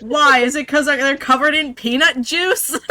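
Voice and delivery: loud, laughing question. Real, not acted. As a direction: ask it while laughing.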